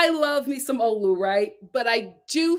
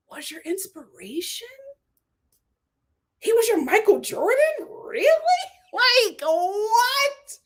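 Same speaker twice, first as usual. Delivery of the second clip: disbelief climbing high at the end; incredulous questioning; 9 semitones higher than usual. Pretend. Host challenging the men about their inspiration, rising incredulity. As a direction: question it as if it cannot be true. Mock-questions the men's choice of idol.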